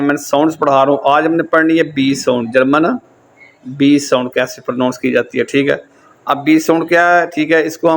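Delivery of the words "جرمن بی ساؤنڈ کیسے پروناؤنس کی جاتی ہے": ordinary delivery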